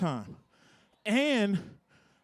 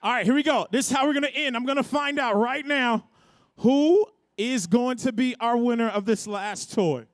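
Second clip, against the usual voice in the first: hype announcer; 4 semitones higher than usual. Acted. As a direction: fire up the crowd. Hype host on the mic.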